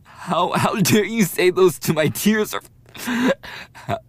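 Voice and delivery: loud, high, crying outrage; tearful yelling. Acted. Rejected bully yells tearfully at the victim. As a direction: scream through the tears.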